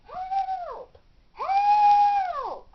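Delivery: high, loud desperate cries for help; frantic high shouts that fade toward the end; calling for help. Acted. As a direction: yell for help from behind locked doors. Trapped girl calling for help.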